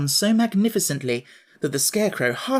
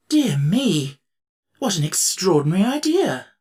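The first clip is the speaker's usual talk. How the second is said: louder, higher astonished emperor; shocked surprise; 4 semitones higher, 4 dB louder than usual. Acted. Emperor hearing the girls took the city, shocked. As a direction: react as if scandalized. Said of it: "both shocked and surprised".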